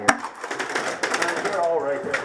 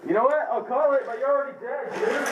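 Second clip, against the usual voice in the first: raised voice; 8 semitones higher, much faster than usual. Real, not acted. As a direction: call him out, heated. Arguing opponent is dead.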